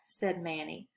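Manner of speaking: ordinary delivery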